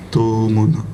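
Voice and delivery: ordinary delivery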